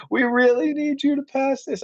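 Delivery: high, pleading politician's plea; Lyndon B Johnson. Retold or imitated. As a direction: coax him like LBJ leaning on a senator. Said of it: "I'm not gonna do the Texas accent".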